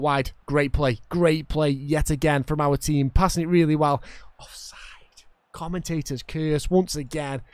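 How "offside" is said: whispered offside. Real, not acted. Muttering the offside call.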